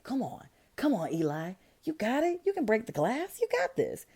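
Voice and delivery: high, mock-peppy cheering on; rah-rah cheering. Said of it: "like, rah-rah".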